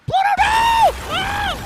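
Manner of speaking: piercingly high, very loud mouse scream; Mickey screaming. Acted. Mouse sees his dog run out the door, screaming. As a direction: scream in horror as a cartoon mouse. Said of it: "I'm on the mic as Mickey".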